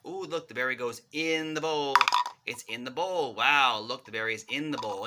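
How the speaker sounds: bright playful demo, leaning on "in" each time; excited stress on in. Shown as a demonstration. Parent showing a child the berry goes in, excited stress. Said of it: "you're really gonna emphasize that word".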